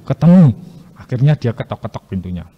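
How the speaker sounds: ordinary talk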